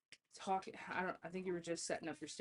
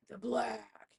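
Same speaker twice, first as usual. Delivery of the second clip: burped word; 4 semitones higher than usual. Retold or imitated. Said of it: "he goes to burp".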